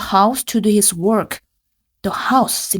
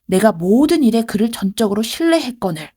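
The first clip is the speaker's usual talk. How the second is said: ordinary talk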